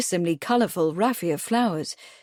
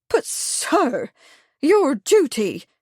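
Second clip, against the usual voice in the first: higher, excited busybody voice; fussy village lady; 7 semitones higher, 4 dB louder, slower than usual. A narrator's reading. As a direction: gush with self-important urgency. Truebody protests.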